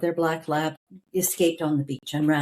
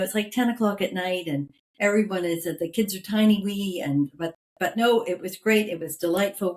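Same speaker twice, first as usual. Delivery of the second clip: ordinary delivery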